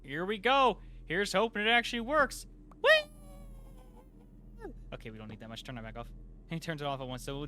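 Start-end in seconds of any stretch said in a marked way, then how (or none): 0.1-2.5 loud and higher with eager hope; excited. Real, not acted. Powering up the reactor. 2.8-4.7 very high playful whee; gleeful squeal. Real, not acted. Reactor powers up.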